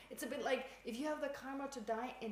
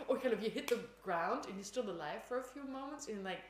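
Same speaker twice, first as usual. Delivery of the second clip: normal talking voice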